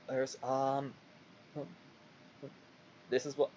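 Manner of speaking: ordinary talk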